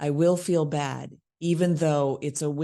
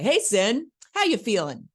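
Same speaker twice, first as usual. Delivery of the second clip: identical canned greeting, high and loud; rote line reading; 5 semitones higher, 5 dB louder than usual. Shown as a demonstration. Coach shows actors always saying it that way, canned loud greeting. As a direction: pitch it up in a fixed memorized pattern. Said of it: "always gonna say it that way".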